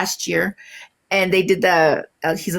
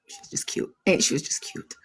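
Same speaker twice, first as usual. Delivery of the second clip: much softer, breathy whisper of fondness; soft aside; 8 dB quieter than usual. Real, not acted. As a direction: whisper it to yourself, proud of her. Fond aside about daughter.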